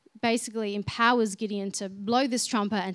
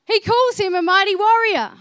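loud, raised, incredulous-sounding emphasis; emphatic preaching; 8 semitones higher, 9 dB louder than usual. Real, not acted. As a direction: drive the point home, high and loud. Preacher drives home the key point.

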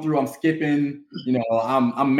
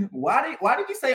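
ordinary talk

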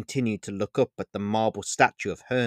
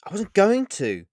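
offended, higher and louder; frustrated; 10 semitones higher, 7 dB louder than usual. Acted. Vega denies to Olivia, frustrated. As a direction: bristle at the insinuation. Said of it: "out of frustration".

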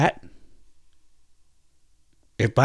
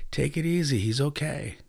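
soft and peaceful, much quieter; peaceful smile; 4 semitones higher, 6 dB quieter than usual. Retold or imitated. Grandfather calming his son, peaceful and soft. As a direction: soothe with a calm smile. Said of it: "like a very peaceful smile".

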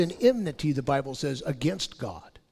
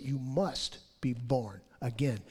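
"must" leaned on, then a small break; stress on "must"; 6 semitones lower, 6 dB quieter than usual. Shown as a demonstration. Preacher quoting Jesus to the congregation, stress on "must". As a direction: put the weight on "must". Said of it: "emphasize the word must".

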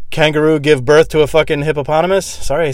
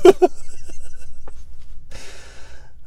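breathless high giggle; real wheezing laugh; high wheezy laugh. Real, not acted. Laughs at own story.

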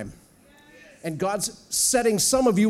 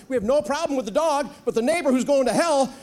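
normal talking voice

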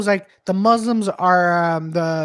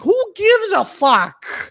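much higher, slow and drawn out; exasperated outburst; 13 semitones higher, 4 dB louder, much slower than usual. Retold or imitated. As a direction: throw it out in disbelief. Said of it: "I'm like".

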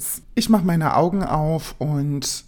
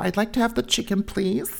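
lightly higher, careful English; polite English order; 6 semitones higher than usual. Retold or imitated. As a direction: ask politely like a nervous newcomer. (höflich auf Englisch bestellen, etwas höher)

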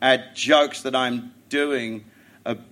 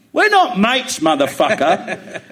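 higher, louder, dragged-out insult; mock snap at the cleaner; mock yell; 8 semitones higher, 7 dB louder, slower than usual. Retelling telling off his cleaner, mock yell.

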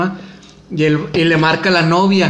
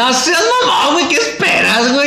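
shouting at a friend for dating a jobless guy; exasperated shout; 6 semitones higher than usual. Real, not acted. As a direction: yell at her in disbelief. Friend scolds her bad choice.

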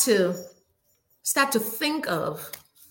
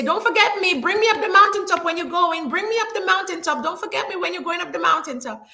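high, loud begging to be brought along; pleading friend; 6 semitones higher, 4 dB louder than usual. Retold or imitated. Friends begging the climber not to leave them, high and pleading. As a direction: whine like a friend afraid of being left behind. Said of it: "we say".

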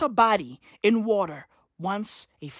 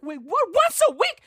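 high-pitched, incredulous questioning; mock panicked disbelief; 9 semitones higher than usual. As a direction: squeak it out, hammed-up shock.

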